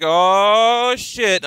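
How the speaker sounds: loud, high mock shock; retelling first seeing the photo, loud and stunned; excited exclamation. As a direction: blurt it out amazed. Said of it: "I was like".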